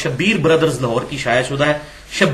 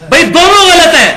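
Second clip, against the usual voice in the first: much higher, louder rebuke; emphatic exclamation; 11 semitones higher, 7 dB louder than usual. Real, not acted. Lecturer rebuking both sides, loud and high. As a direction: burst out that both are wrong.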